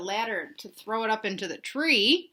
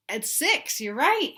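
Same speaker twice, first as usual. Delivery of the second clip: usual speaking voice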